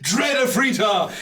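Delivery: booming chief's hail, high and loud; Kodo. Acted. Chief hailing returning heroes as they run past, booming. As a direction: roar out a triumphant, laughing welcome. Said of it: "Kodo's voice as he watches you run past".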